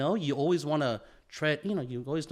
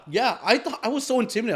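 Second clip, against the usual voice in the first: excited; 10 semitones higher, 7 dB louder than usual. Real, not acted. Amused at own surprise.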